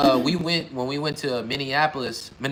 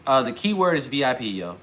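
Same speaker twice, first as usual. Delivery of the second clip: normal talking voice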